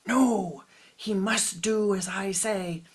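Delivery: loud, sharp angry outburst; furious shout. A narrator's reading. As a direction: slam the refusal down hard. Father furiously overruling the teacher.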